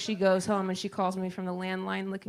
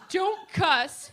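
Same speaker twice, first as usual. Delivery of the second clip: mom scolding; 9 semitones higher, 3 dB louder than usual. Retold or imitated.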